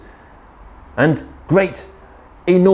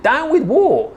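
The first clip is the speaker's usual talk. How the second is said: high hooting slogan; hooted chant; 13 semitones higher than usual. A narrator's reading. Drip gets the war chant wrong, high hoot. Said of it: "and he hooted".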